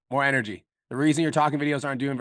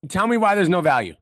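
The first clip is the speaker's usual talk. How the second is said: emphatic frustration; 4 semitones higher, 7 dB louder, slower than usual. Real, not acted. Coach roasting client's video.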